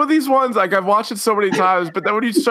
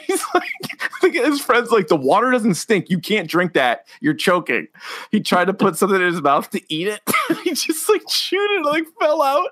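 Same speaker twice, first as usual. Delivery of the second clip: laughing; 2 semitones higher than usual. Real, not acted. Laughing while retelling.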